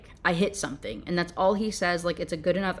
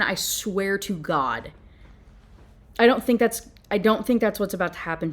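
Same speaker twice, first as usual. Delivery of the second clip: ordinary delivery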